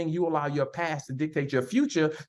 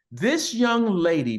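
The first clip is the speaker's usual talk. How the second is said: ordinary talk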